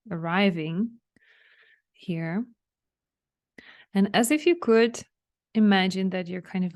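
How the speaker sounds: unhurried relaxation guide with long pauses; spacious, measured meditation lead; guided meditation. Real, not acted. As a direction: guide them gently inward, leaving room to breathe. Leads listeners through a relaxation meditation.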